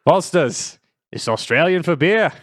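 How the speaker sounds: put-on beer ad line in Aussie accent; Australian accent. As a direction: do the Foster's ad in an Aussie voice. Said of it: "can you give me a quick".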